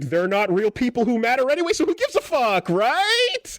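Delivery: shrill-high mocking sarcasm; high sarcastic voice. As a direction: squeak it up, mocking. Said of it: "according to your logic".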